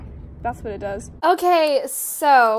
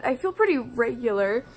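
ordinary talk, nothing marked

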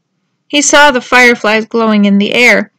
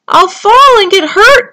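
high, loud, fearful cry; 9 semitones higher, 6 dB louder than usual. A narrator's reading. Tudley on the rock fears falling, crying out. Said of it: "cried Tudley".

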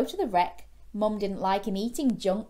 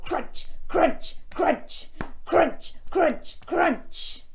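high, loud, punchy bursts; big-toothed crunching; 8 semitones higher, 5 dB louder, much slower than usual. Shown as a demonstration. Storyteller showing children sharp teeth, loud chomping crunches. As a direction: bite out each word like snapping teeth. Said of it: "get your big sharp teeth and go".

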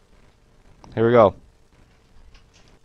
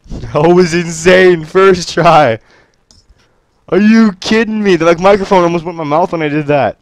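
excited celebrating; 4 semitones higher, 5 dB louder than usual. Real, not acted. Still celebrating the win.

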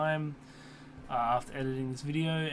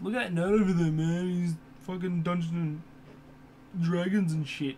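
loud mocking nerd voice; 4 semitones higher, 5 dB louder than usual. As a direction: sneer it like a bully mocking nerds. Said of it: "That's a nerd voice".

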